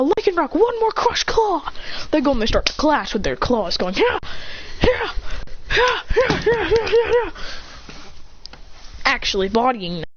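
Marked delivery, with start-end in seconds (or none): 0.0-1.7 high, stretched-out battle call; attack command. Acted. Trainer shouts attack command. 3.9-7.3 short yelps, speeding up; rapid-fire cries with each blow; clashing battle cries. Acted. Pokemon clashing with their claws, quick battle yelps.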